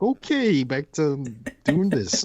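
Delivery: high-pitched, awkward okay; mock uneasy retreat. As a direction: pitch it up, edging away from a weirdo. His uneasy reaction to staring coworker.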